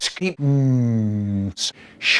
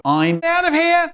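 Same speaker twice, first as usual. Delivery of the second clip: high outburst; 13 semitones higher than usual. Acted. Fleeing in outburst.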